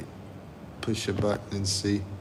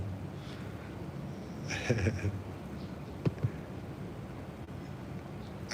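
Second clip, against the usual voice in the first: long breathless wheezing laugh; silent wheeze laugh; 13 dB quieter than usual. Real, not acted. Streamer cracking up at the chat joke, wheezing silently.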